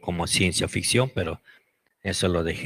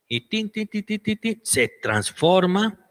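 high chiming tin tin; 12 semitones higher than usual. Retold or imitated. Magic transformation sound effect.

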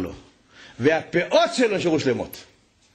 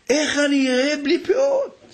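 high, louder tearful plea; crying child; 12 semitones higher, 3 dB louder than usual. Retold or imitated. Sick boy cries to his mother about his sidelocks, sobbing. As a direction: sob out the fear of losing his sidelocks. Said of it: "התחיל לבכות".

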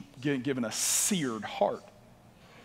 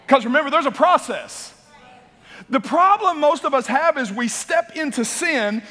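loud, high preaching with raised voice; full-force preaching; 10 semitones higher, 9 dB louder than usual. Acted. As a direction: preach it out to the whole room.

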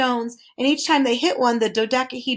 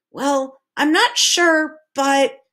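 stammered; 4 semitones higher, 4 dB louder, much slower than usual. A narrator's reading. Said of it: "Milo stammered".

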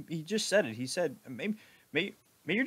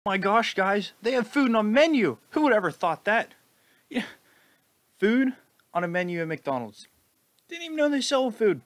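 higher, louder fake amazement; mock astonishment; 7 semitones higher, 5 dB louder than usual. Mocking the menu to viewers with sarcastic awe. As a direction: gasp at the obvious sarcastically.

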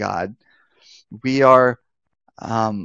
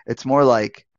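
ordinary delivery

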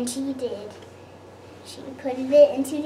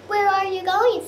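fox voice; 7 semitones higher, 6 dB louder than usual. Acted. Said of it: "said the fox".

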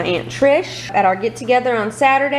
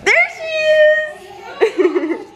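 high, laughing shout; excited laugh; much slower than usual. Real, not acted. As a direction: burst out laughing as you find her. Finding hiding toddler.

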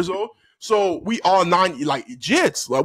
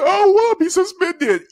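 high-pitched, loud whiny wail; hammed-up schoolboy sob; mock crying; 12 semitones higher, 5 dB louder than usual. Boy begs teacher not to suspend him, mock crying. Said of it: "then he started crying".

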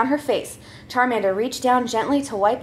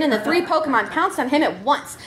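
usual speaking voice

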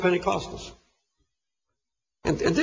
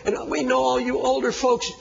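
much higher, pleading and near-crying youth pastor; impassioned plea; 10 semitones higher than usual. Retold or imitated. Youth pastor begging older folks' forgiveness, high and impassioned. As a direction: cry out the apology in a high, choked rush.

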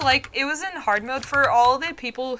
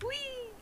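high, soft squealed whee; small, very high-pitched cry of glee; playful whee; 10 dB quieter than usual. Pretend. Player showing friend the thing, high soft whee.

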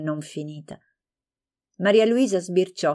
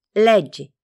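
firm, louder command; decisive; 4 semitones higher, 7 dB louder than usual. A narrator's reading. Franca insisting her friend reads, decisive. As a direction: insist it, firmly. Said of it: "ripeté decisa".

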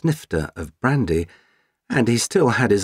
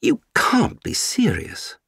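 put-on young Brit; 14 semitones higher, slower than usual. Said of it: "said the Brit".

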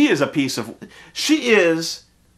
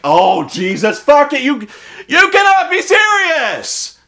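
building to a high, loud yell; exasperated outburst; 7 semitones higher, 5 dB louder than usual. Retold or imitated. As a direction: explode in retold disbelief. Retells outburst at the film.

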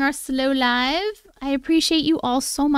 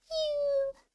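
a higher squeaky meow; second mock meow; 7 dB quieter than usual. Streamer voicing her cat's other meow, higher squeak. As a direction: meow even higher and thinner. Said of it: "and sometimes I meow like".

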